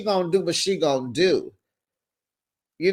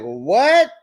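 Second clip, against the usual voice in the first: loud, higher, offended what; indignant what; 5 semitones higher, 6 dB louder than usual. Retold or imitated. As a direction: throw back a stunned what. Boyfriend reacts to being grilled.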